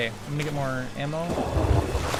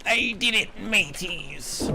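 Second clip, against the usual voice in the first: pirate voice; 6 semitones higher, slower than usual. Acted.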